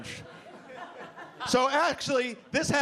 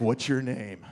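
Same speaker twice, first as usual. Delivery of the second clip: bouncer voice; 8 semitones lower, slower than usual. Retold or imitated. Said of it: "And he's like".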